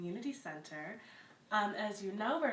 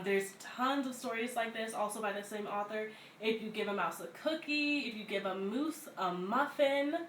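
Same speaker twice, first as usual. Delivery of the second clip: ordinary delivery, nothing marked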